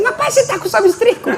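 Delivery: high, laughing mock bravado; jokingly voiced self, laughing; laughing reply. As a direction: laugh while boasting about the wife.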